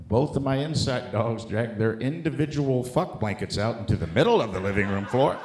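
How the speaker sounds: ordinary talk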